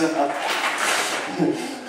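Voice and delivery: a quiet unhinged chuckle; brief soft laugh of madness; soft mad laugh. Acted. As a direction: let a small crazed laugh slip out. Narrator laughs after madness line.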